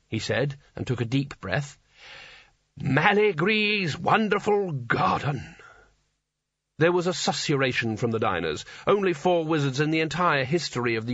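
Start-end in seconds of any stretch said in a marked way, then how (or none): 2.8-5.5 higher, slow and grand; grand announcement. Acted. Old wizard naming his spell to the diners, grand.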